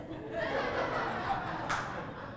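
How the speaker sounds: soft unvoiced laugh of amusement; 1 breathy laugh. Real, not acted. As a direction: laugh quietly at the irony. Laughs at coincidence.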